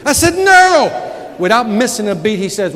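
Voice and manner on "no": loud, higher flat "No"; mock refusal. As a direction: refuse again, firmly. Refusing the kid again.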